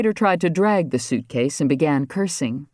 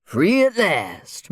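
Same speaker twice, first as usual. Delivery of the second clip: slow, higher free at last; 5 semitones higher, much slower than usual. A narrator's reading. Moses frees the trout back into the river, slow and lifted. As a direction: draw it out slowly, lifted and relieved.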